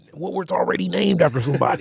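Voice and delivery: quick, hushed Al Prophet voice. Retold or imitated. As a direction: rattle it off in Al Prophet's voice. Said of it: "That's my, that's my Al Prophet voice".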